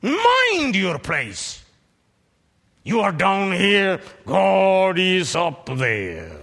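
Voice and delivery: high, loud and slow warning; preacher warning the proud listener; stern rebuke. Real, not acted. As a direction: put them in their place.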